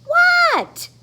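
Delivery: squeaky high startled what. Acted. Froggy startled by teacher's call, high squeak. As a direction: jump in surprise.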